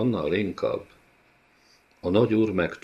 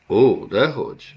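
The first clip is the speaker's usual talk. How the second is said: louder, sweetly false innocence; innocent smile; 4 semitones higher, 5 dB louder than usual. A narrator's reading. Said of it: "Mosolygott szinte ártatlan képpel". (ártatlan mosollyal, gúnyosan)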